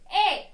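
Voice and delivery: A said as the sound of the letter E, a short clear vowel; teacher sounding out the letter E. Shown as a demonstration. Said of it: "What is the E sound?".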